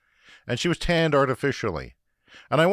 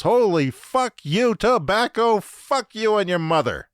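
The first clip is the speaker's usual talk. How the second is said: loud, high outraged curse; raging at tobacco, higher and louder; angry rant; 10 semitones higher, 7 dB louder, slower than usual. Real, not acted. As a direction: yell it at tobacco itself. Ranting at tobacco.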